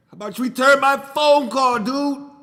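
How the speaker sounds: loud, high teasing demand; mock call-out. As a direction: shout at him to call back. Calling out a friend on video.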